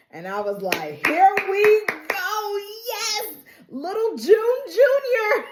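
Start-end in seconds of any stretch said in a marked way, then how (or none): 1.1-5.5 much higher, slow, laughing delight; laughing cheer. Real, not acted. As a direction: savour it through laughter. Viewer cheering Daisy's comeback.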